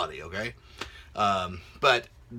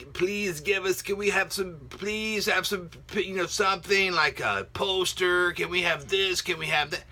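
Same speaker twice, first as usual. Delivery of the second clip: pleading fans; 4 semitones higher than usual. Retold or imitated. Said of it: "we were kinda going".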